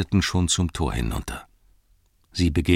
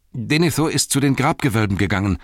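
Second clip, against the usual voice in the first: high, agitated report; anxious telling, pitched up; blurting it out; 6 semitones higher, 3 dB louder than usual. A narrator's reading. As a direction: gush out the news urgently. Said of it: "So sprudelte Pippin die Geschichte hervor".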